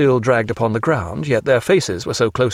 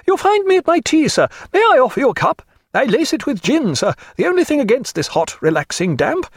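put-on coarse old lady, hearty; 13 semitones higher than usual. Lady Forbes offering Stephen gin-laced tea, hearty high old-lady voice. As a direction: hold forth in a high voice, then call the servant.

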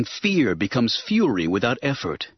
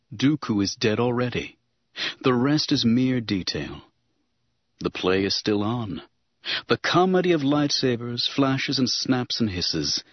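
ordinary delivery, nothing marked

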